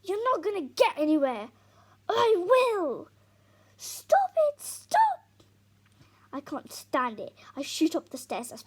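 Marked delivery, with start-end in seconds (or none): 0.0-1.5 loud, high shouting; screaming row. Acted. Dad screams at Tom in the row. 2.1-3.1 high, loud scream back; screamed defiance. Acted. Tom screams back at Dad. 3.8-5.0 very high, loud pleading scream. Acted. Mum screams at father and son to stop fighting. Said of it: "Mum screams at them both to stop".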